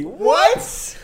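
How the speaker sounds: very high-pitched incredulous yelp; baffled disbelief. Real, not acted. Host hearing about forks replacing spoons, baffled disbelief. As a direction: squeak out utter disbelief. Reacting to absurd listener question.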